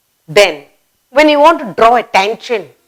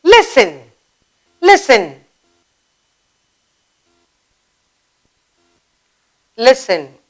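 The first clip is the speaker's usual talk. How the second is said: drawing attention. Shown as a demonstration. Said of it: "Or you might say".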